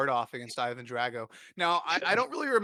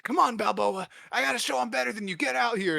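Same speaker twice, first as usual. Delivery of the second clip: high, fast mock taunt; brash young boxer calling Rocky out; taunting challenger; 6 semitones higher than usual. Tommy Gunn taunting Rocky at the bar, fast high taunt. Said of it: "he's like".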